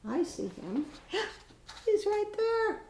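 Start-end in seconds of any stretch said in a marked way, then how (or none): 1.1-2.8 sudden high gasp then delighted pointing out; gasp of discovery. Real, not acted. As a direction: gasp as if spotting him. Spots Max in the picture.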